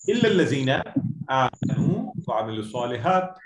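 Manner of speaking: swift chanted Arabic verse; Quran recitation. Shown as a demonstration.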